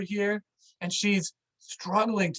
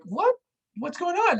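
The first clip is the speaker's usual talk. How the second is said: high startled questions; alarmed confusion; 12 semitones higher than usual. Retold or imitated. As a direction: shoot it up high in disbelief. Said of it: "we're like".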